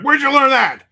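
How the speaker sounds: high, loud shocked mom; mom's angry demand. Retold or imitated. Mother catching her kid swearing, high shocked yell. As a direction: shriek it like an outraged mother. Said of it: "She's like".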